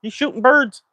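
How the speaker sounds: high, shocked busybody gasp; mock Karen. Neighbour mocking a passing Karen, high shocked gasp. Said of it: "be like".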